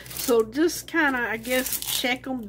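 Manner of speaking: ordinary talk, nothing marked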